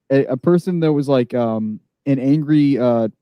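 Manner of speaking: ordinary talk, nothing marked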